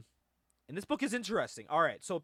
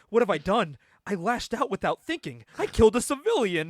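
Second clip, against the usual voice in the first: melodramatic remorse, louder and higher; Wolverine; 5 semitones higher, 8 dB louder than usual. Retold or imitated. Hero horrified at killing a civilian, hammed-up anguish. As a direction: agonise out loud like a comic-book hero. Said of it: "He's thinking that".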